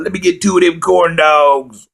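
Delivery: hammed-up victory order at the counter; mock proud order. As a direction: belt the order out like a champion. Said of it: "laughs after retelling".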